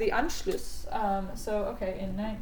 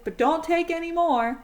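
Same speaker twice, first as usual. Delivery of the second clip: pleading warning; 7 semitones higher, 6 dB louder than usual. Retold or imitated. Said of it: "And they say".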